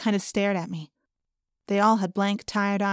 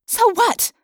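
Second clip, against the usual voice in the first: high, loud comeback; defiant retort; 13 semitones higher, 6 dB louder than usual. A narrator's reading. Rachel answering Jake's scolding, defiant and raised. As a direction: shoot it straight back. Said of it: "I shot back".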